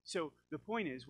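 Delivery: ordinary talk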